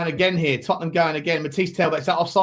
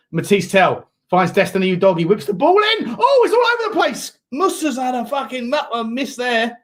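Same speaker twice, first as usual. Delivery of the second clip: football commentator crying out at a missed chance; urgent play-by-play, peaking high then easing; excited live commentary; 8 semitones higher, 5 dB louder than usual. Real, not acted. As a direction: build to a high, loud shout as the ball comes in.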